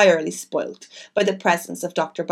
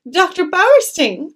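higher, louder, slow surprised cry of the name; exclaiming; 9 semitones higher, 6 dB louder, slower than usual. A narrator's reading. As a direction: announce the unwelcome guest with a cry. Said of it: "exclaimed Cynthia".

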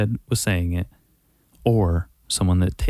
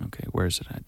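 whisper; 3 semitones lower, 9 dB quieter, much faster than usual. Real, not acted. Searching for the video.

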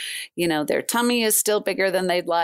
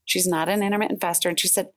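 usual speaking voice

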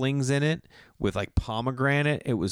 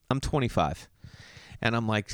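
ordinary talk, nothing marked

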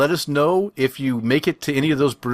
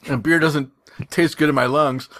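ordinary talk, nothing marked

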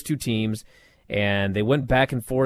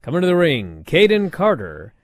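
booming ring announcer intro; 5 semitones higher, 8 dB louder than usual. Pretend. Podcast host mocking a ring announcer for fun, booming. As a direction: announce them like a big ring entrance. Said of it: "they should always announce them".

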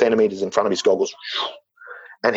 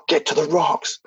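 higher urgent call to swim for the rocks; retold urgent call; 6 semitones higher, slower than usual. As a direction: imitate the brother calling out, higher. Brother urges him to swim.

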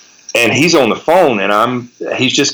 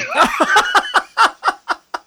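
real high laugh after the punchline; cracking up at the memory; high burst of laughter. Real, not acted. Laughing at own story.

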